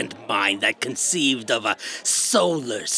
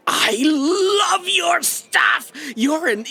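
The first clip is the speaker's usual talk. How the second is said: fan shout; 13 semitones higher, slower than usual. Real, not acted. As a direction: squeal like a starstruck fan. Fan meets favourite author.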